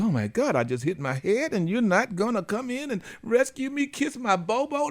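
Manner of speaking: put-on high baby-talk whine; voicing the toddler. Toddler wondering why mom won't come, high whiny voice.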